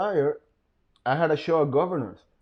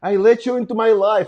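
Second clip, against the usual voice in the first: high, loud mock reproach; imitated ex; 8 semitones higher, 6 dB louder than usual. Ex reproaching him for betraying her, imitated high and loud. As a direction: mimic her wounded outburst, pitched up. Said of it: "she's like".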